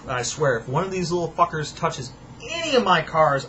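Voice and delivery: usual speaking voice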